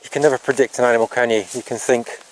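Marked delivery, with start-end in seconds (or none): none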